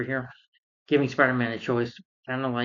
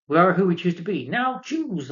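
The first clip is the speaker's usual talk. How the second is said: higher, louder, leaping up at the end; hammy villain ultimatum; 7 semitones higher, 5 dB louder than usual. Retold or imitated. Goblin telling Spider-Man to choose, high hammed-up ultimatum. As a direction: build to a high mock-villain command.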